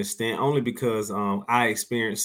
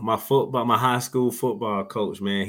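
ordinary talk

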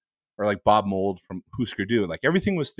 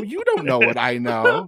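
slow mock bravado; 4 dB louder than usual. Repeated, slower mock bravado.

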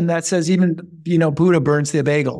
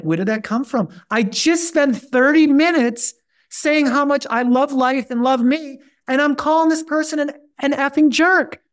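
baffled at his own anger; exasperated inner voice; 7 semitones higher than usual. Retold or imitated. Retelling his own angry inner voice.

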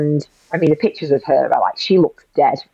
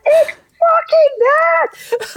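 upset shout; much slower than usual. Retold or imitated. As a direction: howl it out, near tears, very drawn out. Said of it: "And he was so upset".

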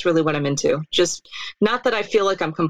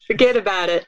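a light New York wiseguy "Forget about it"; hint of a mafia tough guy; New York mobster accent. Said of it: "repeating mobster catchphrase".

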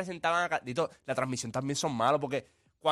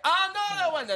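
much higher, louder mock announcer call; imitating announcer; 13 semitones higher, 3 dB louder, much slower than usual. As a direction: repeat the call, pitched way up. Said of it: "y dice". (imitando al locutor, mucho más agudo)

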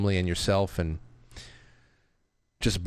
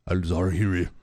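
Al Zawahiri in a mock Chinese accent, pitched lower; lower, jokey Chinese accent on "Al Zawahiri"; 4 semitones lower than usual. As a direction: say "Al Zawahiri" the way a Chinese speaker might, lightly mocking. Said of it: "no, uh, that's the Chinese".

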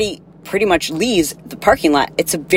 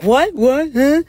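mock indignant huh; 9 semitones higher, slower than usual. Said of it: "giving her a hard time about that. Like".

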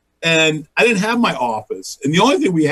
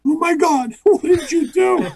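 very high mock horror; horrified husband. Husband learns wife hung up on star, high mock horror. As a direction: squeal it in dismay.

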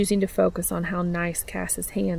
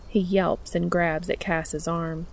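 ordinary delivery

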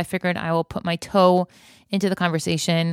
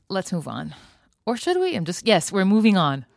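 ordinary delivery

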